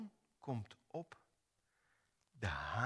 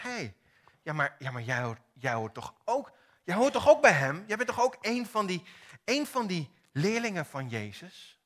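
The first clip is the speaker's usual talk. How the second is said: loud, fast, higher needling accusation; accusing bystander; 4 semitones higher, 9 dB louder than usual. Acted. Bystander pressing Petrus at the fire, loud and insistent. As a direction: corner him with the question.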